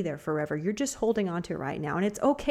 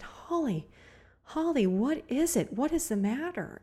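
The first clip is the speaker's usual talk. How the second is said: worried asking; 7 semitones higher than usual. Retold or imitated. Coworker arrives crying, speaker asks.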